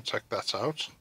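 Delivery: ordinary talk, nothing marked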